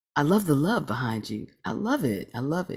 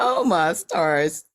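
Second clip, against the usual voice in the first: delighted; 5 semitones higher, 5 dB louder, much slower than usual. Real, not acted. Host delighted by friends' gift.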